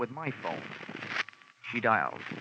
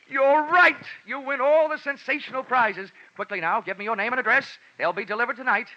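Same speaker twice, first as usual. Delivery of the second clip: loud, higher, hammed-up disc jockey patter; fake radio DJ; 9 semitones higher, 4 dB louder than usual. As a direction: sell it like a pushy radio host on the phone. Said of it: "Happy Jack the Money Man".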